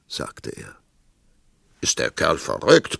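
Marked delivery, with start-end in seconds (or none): 1.8-3.0 bellowing king; roaring tyrant king. Acted. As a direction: roar it like an outraged tyrant. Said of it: "brüllte der König".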